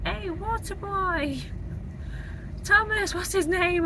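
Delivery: high, sing-song waitress voice; imitating the waitress. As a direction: chirp it like a friendly waitress. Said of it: "there's a waitress who's like".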